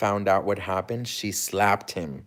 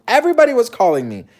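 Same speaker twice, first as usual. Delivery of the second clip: much higher, louder, fast burst; exasperated outburst; 12 semitones higher, 8 dB louder, much faster than usual. Real, not acted. Overwhelmed by his family's calls, high and fast. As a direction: throw up hands at all the calls.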